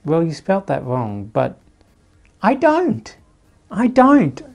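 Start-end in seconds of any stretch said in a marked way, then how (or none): 2.4-4.4 a squeaked, mock-indignant "I don't"; high squeaky denial. YouTuber denies sounding like Jacksepticeye, squeaking high. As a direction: jump way up high and loud to deny it.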